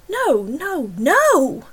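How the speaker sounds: horrified denial. Acted. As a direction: deny it in shock. Accused of hurting daughter.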